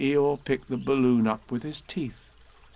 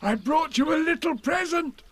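much higher, eager bear voice; excited. Acted. Pooh eagerly telling Eeyore about present, excited. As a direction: bubble over with the news. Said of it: "said Pooh excitedly".

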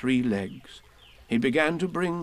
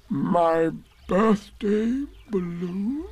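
very slow, rising disbelief; Eeyore, stunned; 7 semitones higher, much slower than usual. A narrator's reading. Eeyore learning his birthday balloon burst, slow stunned question. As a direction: stretch each word, climbing in pitch.

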